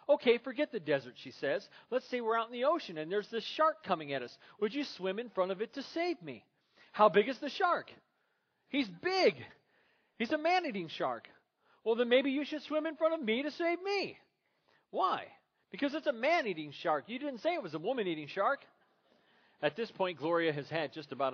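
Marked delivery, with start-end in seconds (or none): 0.1-1.1 higher, louder voices of a bickering couple; acted couple quarrel. Preacher acting out Gloria and Michael, loud and high. 1.9-18.6 higher, louder voices of a bickering couple; acted couple quarrel. Preacher acting out Gloria and Michael, loud and high.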